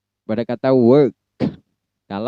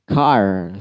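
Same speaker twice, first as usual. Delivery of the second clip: car with a clear R; American car, clear R; 3 semitones lower than usual. Shown as a demonstration. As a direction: make the R in car plainly heard. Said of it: "R-nya wajib jelas".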